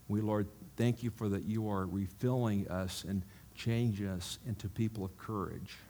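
soft, earnest praying voice; closing prayer. Acted. Pastor prays over the congregation, softly earnest. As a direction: pray it gently over the people.

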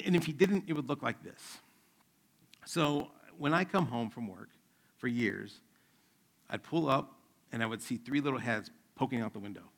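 usual speaking voice

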